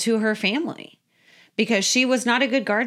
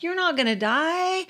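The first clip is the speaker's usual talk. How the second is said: higher, coaxing serpent voice, a bit softer; devil's voice; 7 semitones higher, 3 dB quieter than usual. Retold or imitated. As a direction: tempt her lightly, like the sly serpent. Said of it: "That's always the way the devil sounds".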